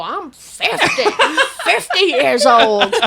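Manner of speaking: high, slow, hammed-up boast; drawn-out character voice, breaking into laughter; Molly Shannon. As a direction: stretch each word like a sketch catchphrase. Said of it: "What would she say when she would kick her leg?".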